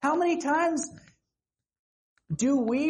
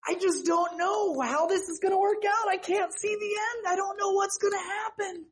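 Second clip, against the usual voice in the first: panicked cry; 11 semitones higher than usual. Acted. Preacher voices a drowning believer, panicked and high. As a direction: cry out like you're sinking. Voicing panicked disciple in storm.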